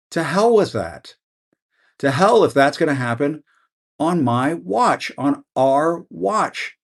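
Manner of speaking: heated rallying cry against Trump; emotional outburst. Real, not acted. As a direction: pour real feeling into every line, pitch up. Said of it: "I'm gonna get emotional about this right now".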